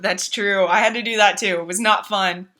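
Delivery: usual speaking voice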